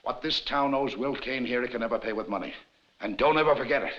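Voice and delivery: usual speaking voice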